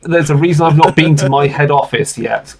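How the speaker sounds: normal talking voice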